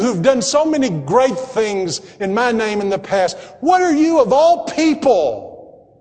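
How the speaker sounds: high, insistent, impassioned questioning; preacher voicing a pleading, incredulous rebuke; God challenging a believer. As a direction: press each question home, peaking then slowing. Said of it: "God might be saying that".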